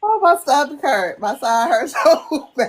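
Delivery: real, helpless laughter through the words; laughing hard. Real, not acted. Listener worn out by the stories, laughing hard. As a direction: break into laughter while complaining of the ache. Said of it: "I've been having you laughing".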